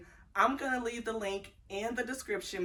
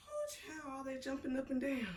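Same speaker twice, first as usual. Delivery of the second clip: out of breath; 4 semitones higher, 9 dB quieter than usual. Real, not acted. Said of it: "I'm out of breath".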